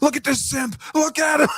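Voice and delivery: shrill mocking yell at the simp; mocking shout. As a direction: jeer at him like an online mob. Mocking forum users shouting.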